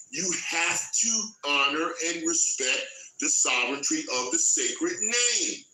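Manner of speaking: usual speaking voice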